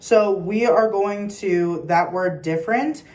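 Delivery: normal talking voice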